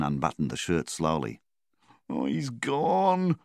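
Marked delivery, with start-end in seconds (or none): 2.1-3.4 slow, higher despairing moan. A narrator's reading. Flynn despairing over Milvan's body, slow high moan. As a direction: let it sag out in grief. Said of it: "he moaned".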